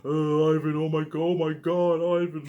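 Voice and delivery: high, wailing mock sobs; imitating crying wife. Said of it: "My wife's gonna be crying".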